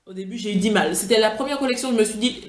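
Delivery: normal talking voice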